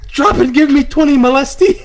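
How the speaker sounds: loud high barked command; barking drill sergeant. Retold or imitated. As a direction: bark the order at a recruit. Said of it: "imagine his drill sergeant".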